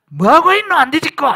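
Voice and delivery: high, loud impatient yell; exasperated, high-pitched bellow; acted scolding shout. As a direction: holler it in regional dialect, demanding action now.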